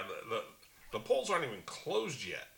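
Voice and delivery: ordinary talk, nothing marked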